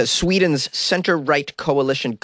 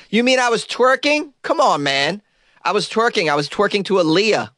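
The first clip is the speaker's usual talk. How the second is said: high-pitched, incredulous accused dancer; mock indignant protest; 9 semitones higher than usual. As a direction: protest the charge in a raised comic voice.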